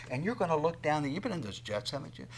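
normal talking voice

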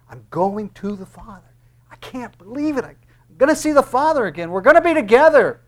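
rising from hushed wonder to loud, high joy; Jesus beside himself at seeing his Father again; growing joy. Acted. Jesus overjoyed at returning to Father.

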